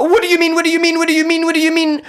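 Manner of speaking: high-pitched rapid repeated question; acted-out frantic bafflement; stressed demanding. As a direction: fire it off in a panicky squeal. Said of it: "I was getting all stressed out".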